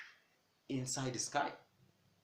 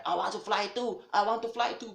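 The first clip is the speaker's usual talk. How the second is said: higher and louder desperate cry; panicked plea; 9 semitones higher, 7 dB louder than usual. Retold or imitated. Doomed chickens crying out to the butcher, panicked. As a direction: beg in panic. Birds panicking, begging to fly.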